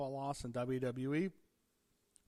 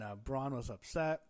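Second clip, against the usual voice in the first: ordinary talk, nothing marked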